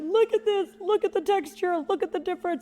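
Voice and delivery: high, insistent pointing out flaws; hammed-up fussy inspector; imitating a frantic perfectionist; mock perfectionist.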